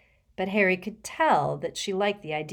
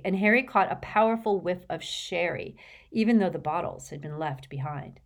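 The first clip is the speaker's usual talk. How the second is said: plain reading voice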